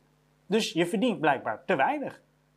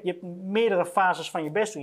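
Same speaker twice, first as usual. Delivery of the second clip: usual speaking voice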